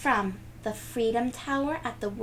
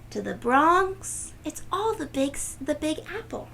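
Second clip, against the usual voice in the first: excited listing; 7 semitones higher than usual. A narrator's reading. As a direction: savour each borough with wonder. Excited tour of boroughs.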